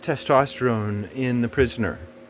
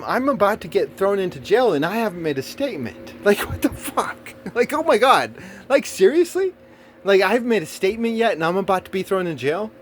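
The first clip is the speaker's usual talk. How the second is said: indignant; 6 semitones higher than usual. Real, not acted. Outrage over no statement.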